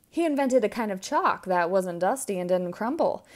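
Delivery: ordinary delivery, nothing marked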